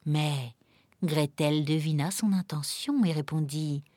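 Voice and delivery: plain reading voice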